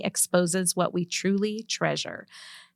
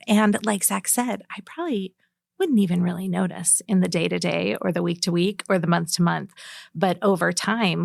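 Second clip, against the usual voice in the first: ordinary talk, nothing marked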